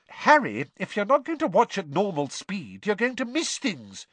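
high-pitched, scolding call; shout. A narrator's reading. Girl scolds her friend over the crowd, lightly shouted. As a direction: pitch it up as if shouting, without much volume. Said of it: "shouted Hermione".

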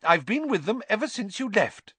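high-pitched indignant defence; bristling. A narrator's reading. Matron vouching for her patients, bristling. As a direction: insist on it, affronted.

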